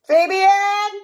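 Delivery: loud call across the house, high and long. Real, not acted. Mom calling her son to come say good morning, a loud high call. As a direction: shout his name to fetch him from another room.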